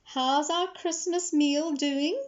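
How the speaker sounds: asking granny. A narrator's reading. Gogo asks after the Christmas chicken, granny asking. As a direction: draw the question out high. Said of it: "she asked".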